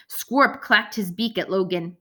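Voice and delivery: usual speaking voice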